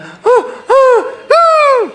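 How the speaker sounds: very high loud gasp, half laugh; sobbing gasp. Shown as a demonstration. Preacher acting out Krishna crying: big gasp. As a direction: overdo the crying gasp for effect. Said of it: "I'm enacting it dramatically".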